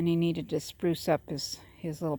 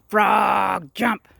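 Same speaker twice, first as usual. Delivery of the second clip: loud high shout, stretched frog; acted yell; 4 semitones higher, 11 dB louder than usual. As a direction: shout it as the mad scientist ordering his frog.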